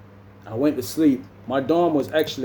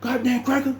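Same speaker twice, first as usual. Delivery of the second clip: raised, heated yell, imitated; high mock fury; 10 semitones higher than usual. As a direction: spit it out at the guy who laughed. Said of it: "He's like".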